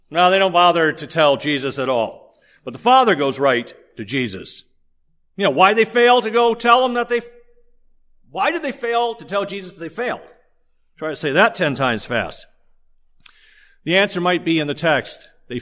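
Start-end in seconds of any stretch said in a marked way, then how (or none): none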